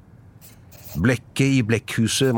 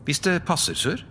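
higher-pitched, deferential clerk; polite; 8 semitones higher than usual. Acted. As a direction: ask the boss meekly and courteously. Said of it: "sa Cratchit høflig". (høflig, lys og underdanig)

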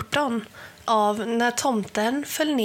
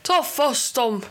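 raised urgent command; shout; 4 semitones higher than usual. A narrator's reading. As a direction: call the guards to attack. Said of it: "skrek Helgerån".

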